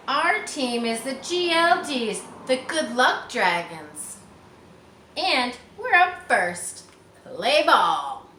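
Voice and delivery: loud, high, slow declaration; a loud proud team call; Mary's shout. Acted. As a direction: call it across the field.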